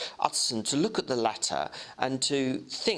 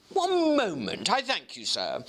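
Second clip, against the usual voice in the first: clerk Guest; 7 semitones higher than usual. Acted. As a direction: draw out the first words, keen to look. Guest asks to compare.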